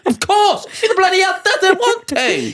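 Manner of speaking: exasperated outburst. Real, not acted. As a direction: throw it back in disbelief. Exasperated answer about wanting vape.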